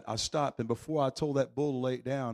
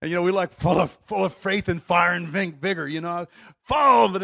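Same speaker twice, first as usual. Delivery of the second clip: loud, higher preacher's fire; full-force preaching; 3 semitones higher, 8 dB louder than usual. Acted. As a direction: preach it hard to the crowd.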